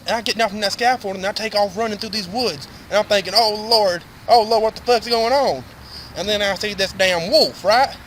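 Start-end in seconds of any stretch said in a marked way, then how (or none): 3.3-5.6 louder, higher panicked outcry; retold panic. Man fleeing through the woods thinks aloud, retold panic. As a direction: play up a bewildered "oh Lord" panic.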